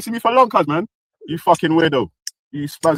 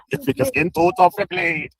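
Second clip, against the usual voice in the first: ordinary talk, nothing marked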